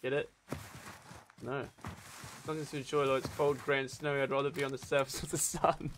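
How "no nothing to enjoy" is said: ordinary delivery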